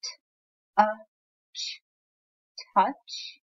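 T- uh ch- split, then blended into touch; teacher blending sounds for readers; sounding out then blending. Shown as a demonstration. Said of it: "Can you find the word touch".

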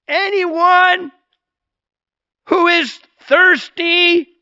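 Jesus shouting to the festival crowd, high and slow. Acted. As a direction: proclaim it to a vast crowd, slowly. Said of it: "he shouted to the crowds".

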